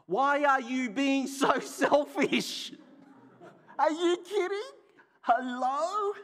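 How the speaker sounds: hammed-up outrage at his selfishness; indignant helper quote. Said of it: "And I quote".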